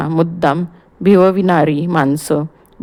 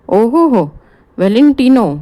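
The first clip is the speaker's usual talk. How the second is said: high, louder delighted exclamation; childlike excitement; 5 semitones higher, 4 dB louder than usual. Acted. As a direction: burst out like a delighted boy. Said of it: "लहान पोराच्या उत्साहाने, आनंदाने". (लहान पोराच्या उत्साहाने)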